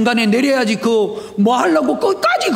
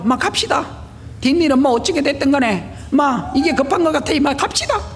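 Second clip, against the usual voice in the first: lightly voiced Gyeongsang accent, urgent; a hint of Gyeongsang dialect, hurrying someone along; 5 semitones higher than usual. Deacon urging the student to the bank, light Gyeongsang accent. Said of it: "경상도 사투리로".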